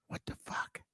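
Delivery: a hushed, breathy whisper; baffled whisper to herself. Retold or imitated. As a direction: whisper it like a secret curse. Retold private exasperation.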